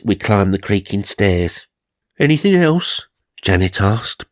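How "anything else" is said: high, breathless question. Acted. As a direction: ask it out of breath.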